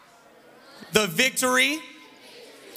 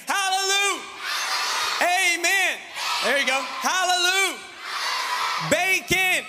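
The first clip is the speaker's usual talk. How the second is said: rousing call-and-response; 8 semitones higher, slower than usual. Real, not acted. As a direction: whip the crowd into shouting back. Leads shouted call-and-response.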